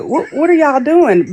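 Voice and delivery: mock shocked question to the fighters; retold alarm. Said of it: "and I'm like".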